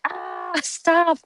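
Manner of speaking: high annoyed mock cry of "stop"; hammed-up exasperated yelp; annoyed protest. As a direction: push away a pest, exasperated. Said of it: "she was like".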